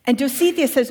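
ordinary talk

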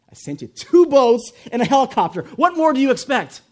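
high, loud, incredulous outburst; exasperated retort. Acted. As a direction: fire back as if it were obvious. God's exasperated punchline.

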